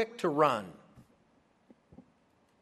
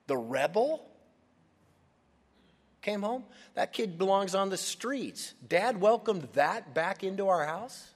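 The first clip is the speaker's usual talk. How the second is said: higher, scornful disbelief; incredulous anger; 5 semitones higher than usual. Acted. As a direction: sneer it like the bitter older brother. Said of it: "Anger, bitterness".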